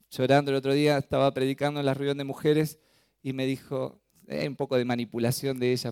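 usual speaking voice

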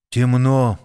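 ordinary delivery

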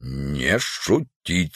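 slow, low, soft stern order; quiet menace of an officer, drawn out; строго. A narrator's reading. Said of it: "сказал строго офицер". (строго, тихо и медленно)

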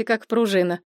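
ordinary delivery

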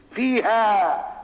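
فيها drawn out very slowly and pitched high; stress on فيها. Shown as a demonstration. Teacher stressing فيها to his student, drawn out and high. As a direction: lean long and hard on فيها. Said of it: "فيها مش فيه".

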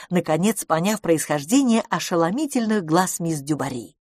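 ordinary delivery

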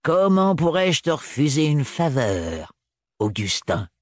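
lightly voiced crone with a grin; a hint of a sly old witch; witchy old woman. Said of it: "grigna un sourire de sorcière".

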